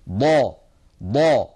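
ضا said loudly, full and heavy from the side of the tongue; emphatic ḍād. Shown as a demonstration. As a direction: press out ضا from the edge of the tongue against the upper molars. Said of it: "من حافة اللسان اليمنى أو اليسرى".

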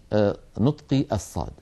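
ordinary talk, nothing marked

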